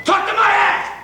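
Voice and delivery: defiant outburst. Acted.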